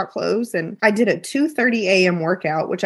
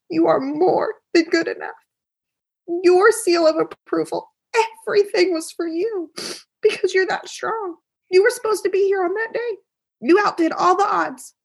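higher, fervent encouragement to her son; a mother telling her struggling little boy he is more than enough; impassioned mother's pep talk; 9 semitones higher than usual. Pretend. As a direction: pour belief into him, rising with feeling.

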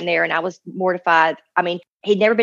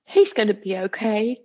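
angelic voice. Retold or imitated. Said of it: "he said it in this voice that, like, was an angel".

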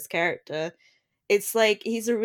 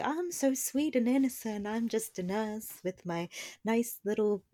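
softer, sugary little-cutie voice, lightly done; a hint of a prim sweet nurse; mock sweet innocent; 8 dB quieter than usual. Mimicking Dolly as a sweet innocent nurse, softly sugary. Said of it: "like, little cutie".

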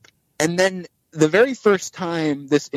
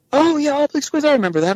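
much higher-pitched acted recall; imitated delighted recognition; faster than usual. Actor recalls the fan's old podcast, imitated high excited recognition. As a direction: play sudden recognition, pitch jumping up.

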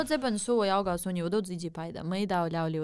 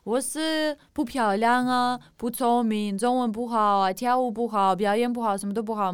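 mock insults; 4 semitones higher, 6 dB louder than usual. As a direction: rattle off the critics' jabs, louder. Listing insults she hears.

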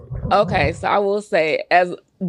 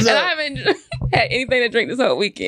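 laughing; 6 semitones higher, much faster than usual. Real, not acted. Tipsy guest laughing through protest.

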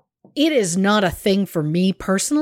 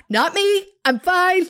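much higher, falsely bright denial; mock I'm fine; 8 semitones higher than usual. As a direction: pretend nothing's wrong, fooling no one. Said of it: "I should have stopped what I was saying and gone".

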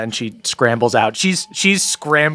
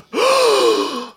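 sharp high gasp; sudden high intake of breath; collective gasp. Acted. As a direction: gasp like a shocked crowd. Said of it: "You hear a collective gasp".

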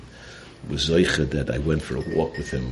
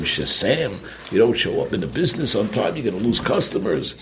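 ordinary talk, nothing marked

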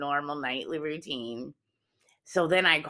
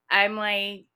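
usual speaking voice

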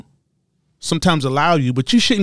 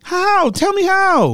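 mock incredulous "how"; much slower than usual. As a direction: squeal it like you can't believe it. Mock incredulous "How, Sway?" bit.

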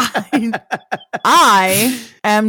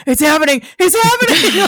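excited; 6 semitones higher than usual. Retold or imitated. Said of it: "I was like".

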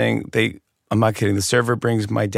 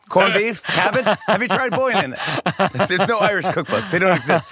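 mock Irish mom; 8 semitones higher than usual. Mocking Irish cooking.

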